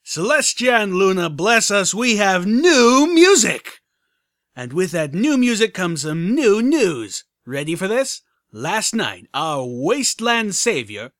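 loud, high radio DJ hype; DJ Pon3. Acted. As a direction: hype up the listeners like a radio host. Radio host voice.

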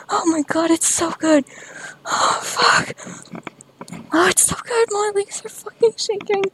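high, breathless ecstatic moaning; high ecstatic cries. Real, not acted. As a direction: cry out in pleasure.

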